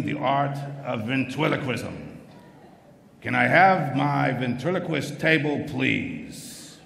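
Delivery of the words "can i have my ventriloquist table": ordinary talk, nothing marked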